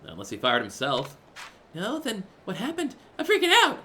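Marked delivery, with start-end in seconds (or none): 1.7-3.8 panicky shriek. Acted. Panicked host club customer.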